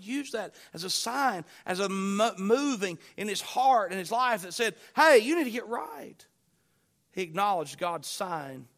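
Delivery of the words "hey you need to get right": loud, raised preacher's urging; forceful voice of conviction; urgent admonition. Acted. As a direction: call him out firmly, high and loud. Voicing God's urging to a man.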